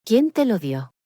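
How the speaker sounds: insist, a touch of lost composure; insistent near-shout. A narrator's reading. Grandparent presses waiter about the note, mildly near shouting. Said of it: "casi gritando, perdiendo completamente la compostura".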